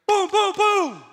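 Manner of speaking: booming imitation of pounding on the door; loud door knocking. Startled at night, mimicking cops banging on the door. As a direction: shout it like fists hammering wood.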